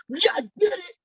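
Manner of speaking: high, delighted little cheer; happy triumph. Retold or imitated. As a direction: crow about the first sale. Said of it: "I was so happy".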